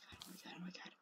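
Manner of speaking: tiny voiceless whisper; shaken whisper. Real, not acted. Player recovering from an unseen attack, shaken whisper. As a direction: whisper it to yourself after a fright. Aftermath of the scare.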